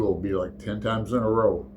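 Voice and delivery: usual speaking voice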